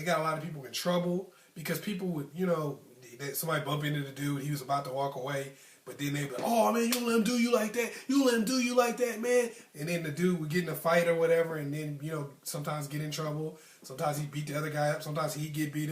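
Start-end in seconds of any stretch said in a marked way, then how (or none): 6.4-9.5 high-pitched mock goading; raised, insistent mock outrage; imitated egging on. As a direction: imitate instigators winding up their friend. Imitates friends egging someone into fight.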